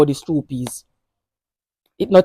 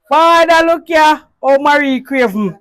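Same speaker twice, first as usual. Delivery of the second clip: loud mock cry; 9 semitones higher, 8 dB louder than usual. Calling out loudly in mock outrage.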